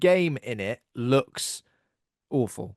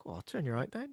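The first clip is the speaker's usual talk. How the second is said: much softer, under-the-breath aside; said to oneself; 12 dB quieter than usual. Retold or imitated. Repeating friend's line to oneself, quiet aside. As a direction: say it quietly, as if only to yourself. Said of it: "I say it to myself sometimes".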